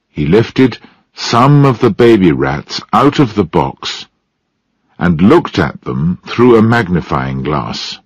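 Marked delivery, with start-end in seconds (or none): none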